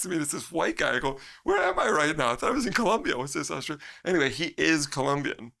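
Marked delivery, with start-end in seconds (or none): 1.4-3.8 baffled disbelief. Retold or imitated. Retold baffled reaction.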